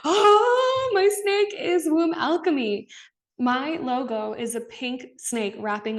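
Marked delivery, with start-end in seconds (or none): 0.1-2.9 much higher, louder, bursting with delight; sudden realization. Retold or imitated. Realizing her snake means Womb Alchemy, thrilled cry. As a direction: shriek it out as the penny drops. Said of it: "And I was like".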